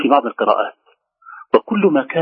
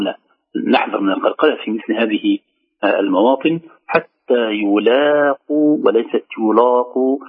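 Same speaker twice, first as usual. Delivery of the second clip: ordinary talk, nothing marked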